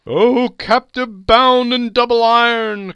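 slow, high, loud wail; phantom's cry. Acted. The phantom crying out at Scrooge, slow, high and loud. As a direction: cry it out over the clanking chains. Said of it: "cried the phantom".

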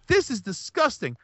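wife recoiling at a gross movie, mock disgust. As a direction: play the grossed-out wife, pitch up a bit. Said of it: "She's like".

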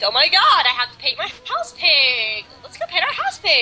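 put-on high, swooping valley girl squeal; gushy valley girl impression. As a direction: put on a mock valley-girl whine, pitch leaping up. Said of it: "like, Poland talks like a valley girl".

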